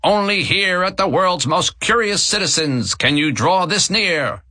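high, showy barker pitch. A narrator's reading. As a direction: call out to draw them in. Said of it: "The voice grew louder".

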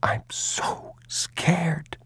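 put-on very slow, voiceless child's whisper; frightened whisper. The hiding boy confesses his fear, in a slow whisper. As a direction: barely breathe it, terrified.

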